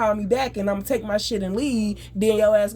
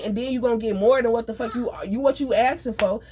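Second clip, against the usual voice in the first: ordinary delivery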